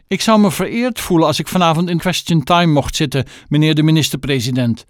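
grovel to the prime minister in your finest voice; mother fawning over the prime minister on the phone; fawning posh voice. Acted. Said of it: "nog veel chiquer dan haar gewone telefoonstem".

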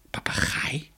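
puzzled whisper. Acted. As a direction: breathe it out in wonder. Kinderen verbaasd in stil bos. (verbaasd gefluisterd)

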